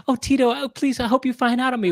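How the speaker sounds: high, pleading mock voice; hammed-up begging; teasing impression of friend. Mimicking friend playing the stuck man, mock pleading. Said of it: "You go like this".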